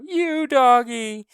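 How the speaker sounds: put-on high, slow, loud child's wail; little girl wailing for her dog. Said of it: "Honey began to cry".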